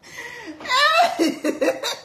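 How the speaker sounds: high, loud burst of laughter. Real, not acted. Mother cracking up at her own slip, loud laugh. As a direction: burst out in a real shrieking laugh.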